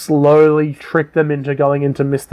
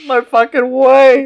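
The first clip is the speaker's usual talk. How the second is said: high, slow, drawn-out disbelief; mock disbelief; 9 semitones higher, slower than usual. Watching the gnome twist, slow high disbelief. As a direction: stretch it out high, as if it can't be true. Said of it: "I was like".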